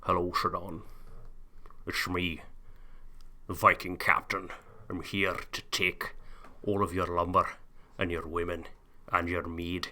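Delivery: low, soft, hammed-up Norse captain; a lightly put-on Viking brogue; a hint of an old Norse sea captain; mock Viking accent. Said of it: "Historically accurate Norwegian Vikings".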